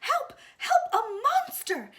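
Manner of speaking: high, loud scream of fright; terrified shout. Acted. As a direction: shriek it in terror. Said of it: "shouted Baby".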